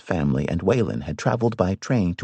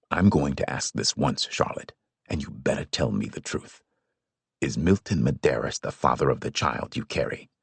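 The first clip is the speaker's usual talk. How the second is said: lower, softer grave father; stern father; 7 semitones lower, 5 dB quieter than usual. A narrator's reading. Father questions his daughter, low, soft and grave. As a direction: ask once, quiet and grave.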